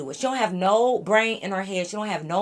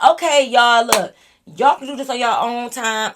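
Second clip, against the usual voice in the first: raised, louder voice to break it up; loud call-out; 4 semitones higher, 9 dB louder than usual. Retold or imitated. Speaker cutting off friends in the car, loud call-out. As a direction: call over them to stop. Calling out loud to stop them.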